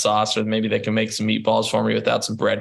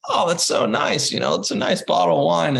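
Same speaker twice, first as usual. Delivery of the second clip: mock gushing; 7 semitones higher than usual. Mock gushing over the sent wine.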